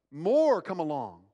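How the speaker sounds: normal talking voice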